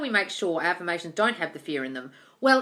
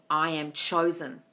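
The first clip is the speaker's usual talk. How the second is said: slow confident affirmation; 2 semitones lower, slower than usual. Shown as a demonstration. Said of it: "maybe I just stop saying".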